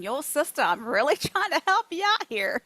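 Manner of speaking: voice of the body. Acted. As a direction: play the body pleading its case, amused. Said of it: "But our body's going".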